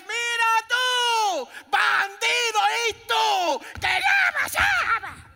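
slow, high screaming at a spouse; furious shout. Acted. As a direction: yell like a fed-up wife. Married couple shouting acted.

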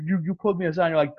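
usual speaking voice

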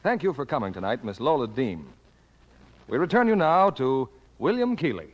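usual speaking voice

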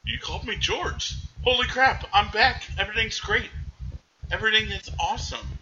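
high, giddy, gushing relief; overjoyed George. Retold or imitated. George to Bert, on realising he's back, high and overjoyed. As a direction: bubble over like a man who just got his life back. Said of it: "He's like".

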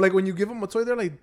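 ordinary delivery

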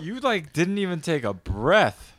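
amazed tease. Real, not acted. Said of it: "teasing the long retelling".

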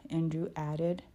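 plain reading voice

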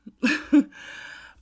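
sudden high loud laugh breaking out; burst of laughter. Real, not acted. Bursts out laughing after crying tangent.

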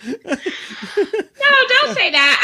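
high, loud, laughing objection; laughing protest. Real, not acted.

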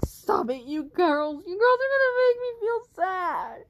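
from firm to a loud, high wail; growing upset. Acted. Naomi begs the girls to stop, building to a loud wail. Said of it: "Naomi went like".

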